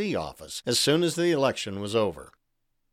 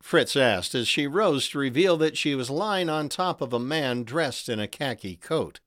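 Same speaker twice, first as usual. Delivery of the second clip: usual speaking voice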